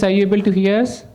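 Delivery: usual speaking voice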